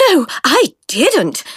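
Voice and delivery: out of breath, gasping; breathless and slow; panting. A narrator's reading. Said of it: "panted Francis".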